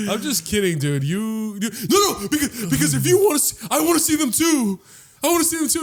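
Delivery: high, laughing, over-eager plea; over-eager friend. Retold or imitated. Friend pleading to see the ghosts, high and laughing. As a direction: laugh then beg to see them too. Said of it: "you'd be like".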